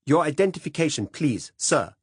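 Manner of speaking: firm demand. A narrator's reading. Cop demands identification.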